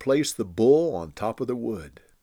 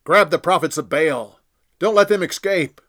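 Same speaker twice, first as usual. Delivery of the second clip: louder, higher urgent command; commanding order; 6 semitones higher, 7 dB louder than usual. A narrator's reading. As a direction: order the crowd to seize them. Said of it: "Elijah ordered".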